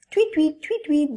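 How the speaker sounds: put-on high chirpy tweets for baby birds; chick chirps. Teacher showing children how the chicks chirp, high and chirpy.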